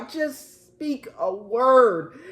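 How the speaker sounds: "just speak a word" slowed right down, each word drawn out; deliberate, unhurried "just speak a word"; slow repeat. Acted. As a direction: spell it out word by word. Commander's plea repeated slowly.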